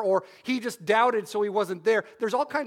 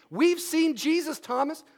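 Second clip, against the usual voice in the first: higher, eager announcement; cheerful nagging, pitched up; eager insisting; 7 semitones higher than usual. Retold or imitated. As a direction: disciples pestering Thomas with good news. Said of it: "you could imagine what this is like for a week".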